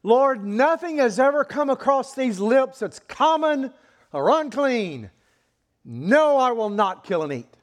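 loud, high indignant protest; emphatic refusal. Acted. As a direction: declare the refusal forcefully. Peter's emphatic refusal acted.

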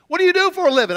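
lightly voiced street preacher asking; mock preacher voice. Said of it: "he says".

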